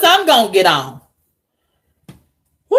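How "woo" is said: short high "woo"; exasperated whoop. Real, not acted. Vlogger dreading the bus terminal meeting, exasperated whoop. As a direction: let out a quick high whoop. Exclaims before "Lord have mercy.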